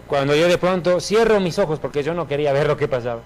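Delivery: ordinary talk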